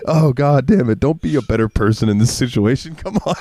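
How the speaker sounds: high, pleading complaint, rising on come on; mock exasperation. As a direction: beg him to just mock you. Retold frustration at friend's kindness.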